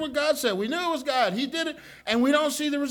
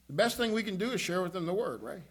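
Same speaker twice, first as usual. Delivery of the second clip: ordinary talk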